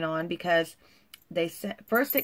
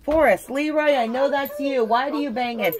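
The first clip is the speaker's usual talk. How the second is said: higher and louder, a real call across the house; 8 semitones higher, 8 dB louder than usual. Real, not acted. Mother interrupting her video to call out to her banging kids, loud.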